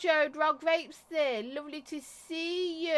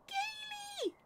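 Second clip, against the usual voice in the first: squeaked way up high, softer; very high squeal; 8 dB quieter than usual. Shown as a demonstration. Streamer repeating the high-pitched squeal to show it. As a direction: squeak the name like a dolphin call. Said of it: "so high pitched that dolphins could hear".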